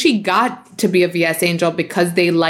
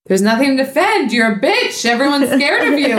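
higher mock mom voice, brushing her off; imitating mom; 7 semitones higher than usual. Host quoting mom insulting her, mocking. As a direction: deliver it as a dismissive mom caricature. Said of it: "And she's like".

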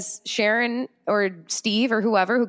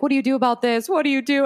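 higher, urgent retold panic; mock-frantic questioning; freaking out; 7 semitones higher than usual. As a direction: imitate yourself pleading for advice in a panic. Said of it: "I was freaking out".

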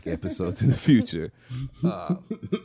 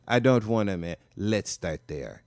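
put-on [name] accent; 4 dB quieter than usual. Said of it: "my [name] accent is pretty good".

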